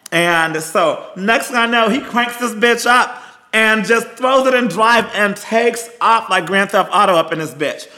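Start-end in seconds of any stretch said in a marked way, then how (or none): none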